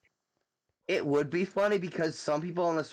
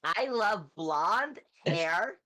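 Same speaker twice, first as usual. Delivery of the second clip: high ditzy airhead voice; high, bubbly bimbo character; 4 semitones higher than usual. Pretend. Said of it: "love doing my bimbo voice".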